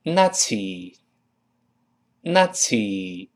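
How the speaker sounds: "Nazi" as naht-see, slow and spaced; slow, careful "Nazi" for learners; ts pronunciation. Shown as a demonstration. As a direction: model "Nazi" with a voiceless ts. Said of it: "我们读成".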